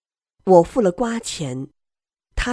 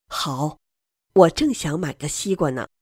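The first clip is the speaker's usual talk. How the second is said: ordinary delivery, nothing marked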